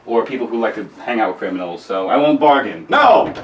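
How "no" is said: loud high no; shouted no, cutting him off; sharp shout. Acted. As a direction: bark the refusal. Outburst refusing deal.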